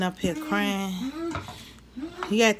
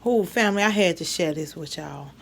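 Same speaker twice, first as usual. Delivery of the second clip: usual speaking voice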